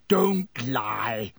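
short angry bellow. A narrator's reading. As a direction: bellow it in mild fury.

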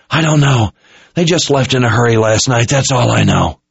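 hurt officer giving up what he knows; frightened captive officer. A narrator's reading.

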